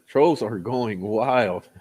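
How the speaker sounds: slow and deliberate, each word stretched; slow, drawn out. Acted. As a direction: drag it out for effect. Repeated slowly for emphasis.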